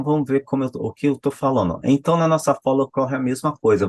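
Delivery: ordinary talk, nothing marked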